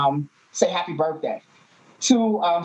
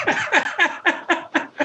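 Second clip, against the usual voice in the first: laughing; 13 semitones higher than usual. Real, not acted. Host laughing at the clip.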